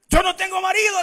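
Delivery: high, loud acted denial; protesting Samaritan woman. Woman insisting to the Lord she has no husband, emphatic. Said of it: "le dice la mujer".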